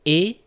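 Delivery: "et" as a closed French é, loud and high; closed é sound. Shown as a demonstration. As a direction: isolate "et" as a tight ay vowel with no glide. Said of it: "that we have in the infinitive form".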